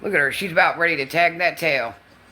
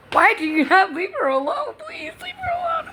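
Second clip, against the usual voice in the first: very high squeaky plea; pleading dog voice. Real, not acted. Nana pleads with the puppy in a very high squeak. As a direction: beg the puppy to stop.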